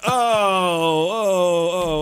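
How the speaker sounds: loud, drawn-out mock moans of embarrassment; mortified moaning. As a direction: replay helpless dismay in repeated loud groans. Left exposed on all fours.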